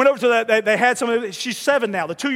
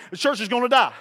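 ordinary talk

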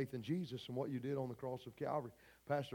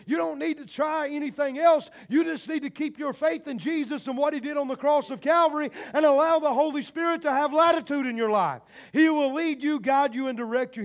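high, loud preacher delivery building to a shout; loud, high-pitched sermon fervor; full-force preaching; 11 semitones higher, 12 dB louder than usual. Real, not acted. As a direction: preach it hard, driving up to a shouted climax.

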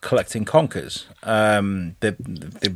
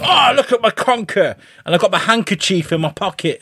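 high, excited mock boast; show-off conker player; 8 semitones higher than usual. Player showing off a champion conker, high mock boast. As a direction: proudly show it off in a pitched-up voice. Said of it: "And you're like".

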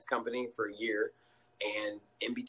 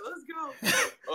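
hype cheer. Retold or imitated. Teammates hyping him up.

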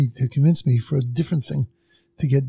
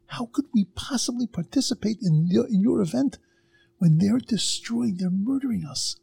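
indignant protest; 6 semitones higher than usual. Retold or imitated. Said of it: "let this person have it".

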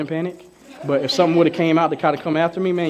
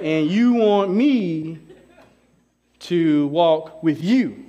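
slow incredulous; much slower than usual. Retold or imitated. Incredulous question to God.

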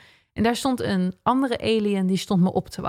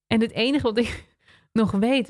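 ordinary talk

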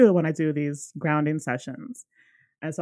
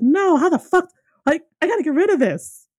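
panicked outburst; 11 semitones higher, 6 dB louder than usual. Retold or imitated. Panicked reaction to entities.

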